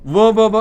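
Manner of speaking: loud, repeated wa for one; sounding out wa. Shown as a demonstration. Teacher to students, drilling the w sound. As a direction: stress the w at the start of one. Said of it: "So हम कहते हैं he is a".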